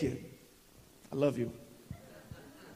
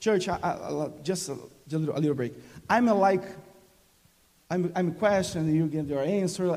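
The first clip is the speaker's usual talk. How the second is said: normal talking voice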